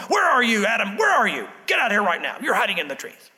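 mock parental scolding, calling out sharply; angry parent imitation. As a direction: imitate a cross parent hunting down a child. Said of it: "like your mom did or your dad did".

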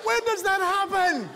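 baffled, mock-outraged question; incredulous. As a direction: ask it in amazed disbelief. Incredulous rant, high.

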